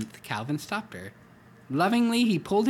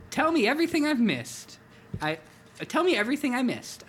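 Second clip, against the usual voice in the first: much higher, eager tiger; excited; 10 semitones higher than usual. Acted. Tiger to old friend, excited and high. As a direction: burst with eagerness to hear it all.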